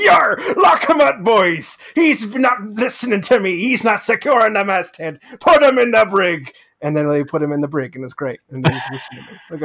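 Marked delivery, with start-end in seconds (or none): 0.0-6.5 loud commanding pirate shout. Retold or imitated. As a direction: bark orders like a pirate captain. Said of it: "I talked in a pirate voice".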